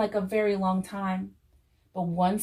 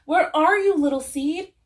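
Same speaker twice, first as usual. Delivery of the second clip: high, loud searching call; 8 semitones higher, 8 dB louder than usual. A narrator's reading. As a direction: shout for a missing friend. Fox searches for friend.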